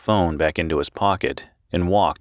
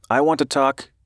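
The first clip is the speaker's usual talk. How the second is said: higher-pitched, nervous reporter; 8 semitones higher than usual. A narrator's reading. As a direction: open tentatively in a raised voice. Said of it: "he said nervously".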